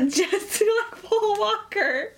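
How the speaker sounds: high, slow laughing wail. Real, not acted. Echoing friend with mock wail.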